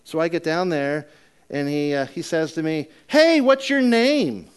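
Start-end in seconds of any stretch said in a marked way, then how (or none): 3.1-4.5 shouted high across the yard, slowly; calling out. Retold or imitated. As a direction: call out to a neighbor far off. Neighbor calling over the bushes.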